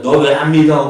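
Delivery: loud, high scolding yell; mock rebuke. Preacher imagining us scolding doubting Thomas, loud high rebuke. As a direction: rebuke him sharply, voice up high. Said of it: "하고 책망했다면은".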